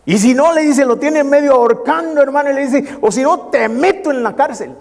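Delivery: telling it with rising heat. Retold or imitated. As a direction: push the threat up high. Tells the threat, pitch high.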